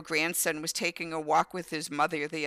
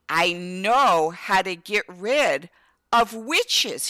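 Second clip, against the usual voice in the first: slow, louder, drawn-out reassurance; slow confiding promise; 5 dB louder, much slower than usual. Retold or imitated. Mom telling scared son she can banish witches, slowly.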